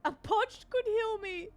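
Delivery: high slow put-on read. Reading game text oddly.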